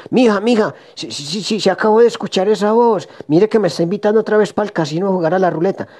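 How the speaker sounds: aviso urgente, agudo y atropellado; despiértala con apuro y voz alta de tono; llamado agitado. Acted. (marido alborotado avisando)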